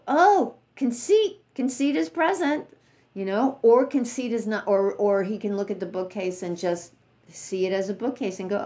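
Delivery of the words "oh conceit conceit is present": noting monk. Retold or imitated. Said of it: "And then he'll go".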